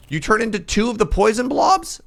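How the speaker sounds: high, loud shocked question; shocked disbelief. Real, not acted. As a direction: cry out in disbelief. Shocked at enemy splitting.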